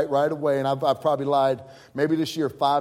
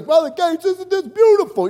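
put-on much higher, louder Italian-accented gush; Italian impression; 13 semitones higher, 9 dB louder than usual. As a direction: gush over the view like an excited Italian pastor. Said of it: "You know, those Italians".

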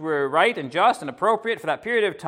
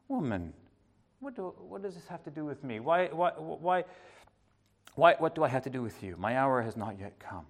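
gentle; 6 dB quieter than usual. Pretend. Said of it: "he said it the same".

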